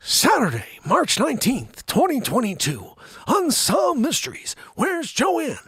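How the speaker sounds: hammed-up, high, punchy TV mystery narrator; Unsolved Mysteries host. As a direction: announce a mock mystery episode with dramatic questions. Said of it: "You got an Unsolved Mysteries episode".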